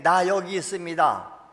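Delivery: 1 shout it out so the rescuer hears; 1 calling out for rescue. Pretend.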